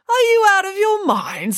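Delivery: very high-pitched, aghast question; horrified. A narrator's reading. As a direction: protest in horror, voice shooting up. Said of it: "He looked horrified by the suggestion".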